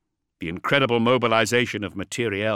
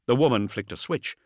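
usual speaking voice

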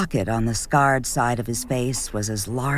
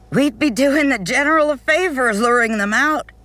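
much higher, louder tipsy exclamation; too loud, laughing; 13 semitones higher, 4 dB louder than usual. Acted. Chaddock to the group on the tram, loud and laughing. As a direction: blurt it out drunk and laughing. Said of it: "Chaddock said much too loudly, laughing as she did".

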